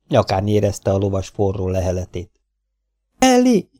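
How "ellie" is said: high, loud frightened shriek; terrified scream. A narrator's reading. Mabel waking on the kidnapper's horse, screaming in terror. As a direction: scream the name in sudden terror. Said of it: "sikoltott fel rémülten".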